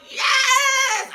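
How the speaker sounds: high excited shriek of joy; delighted scream. Retold or imitated. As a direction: scream yes like you won. Screaming her delight at a guy.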